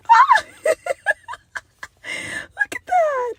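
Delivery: high shrieking squeal and laugh; squeal of delight. Real, not acted. Unboxing a camera for viewers, real shrieking delight.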